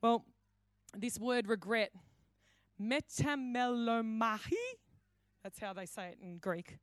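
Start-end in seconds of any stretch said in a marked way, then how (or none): 2.8-4.5 metamelomahi drawn out slowly, lifting at the end; pitch rise at end. Shown as a demonstration. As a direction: sound out "metamelomahi" slowly with a little final lift. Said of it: "do that little pitch rise at the end".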